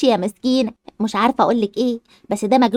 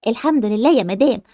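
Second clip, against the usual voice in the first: usual speaking voice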